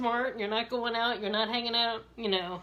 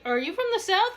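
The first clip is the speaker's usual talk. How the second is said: curious; 9 semitones higher, 5 dB louder than usual. Retold or imitated. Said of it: "I'll be like".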